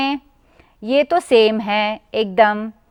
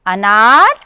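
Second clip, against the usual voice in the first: usual speaking voice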